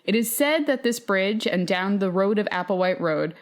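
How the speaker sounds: ordinary talk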